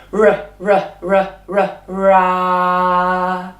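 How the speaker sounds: repeated r from the back of the mouth, then ra; rolled back r. Shown as a demonstration. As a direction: growl r r r into ra. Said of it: "using the back of the tongue".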